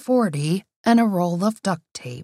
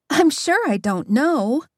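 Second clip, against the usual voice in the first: slow, higher feigned innocence; comedic innocence; 5 semitones higher than usual. A narrator's reading. Sister denies knowing where the chicken is, mock innocent. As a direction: play innocent, drawing it out. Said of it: "near comedic innocence".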